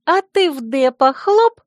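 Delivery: high, drawn-out playful tease; smirking tease. A narrator's reading. Friend teases the heroine about kissing Dep, smirking. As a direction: tease with an audible smirk, slowly. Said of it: "ухмыльнулась Царья".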